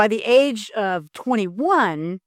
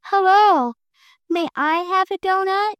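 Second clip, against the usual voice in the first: much higher, tiny polite voice; little guy politely asking Panda for a donut, tiny and high; 11 semitones higher, 3 dB louder than usual. Acted. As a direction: ask sweetly like a very small creature. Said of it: "one little guy who says".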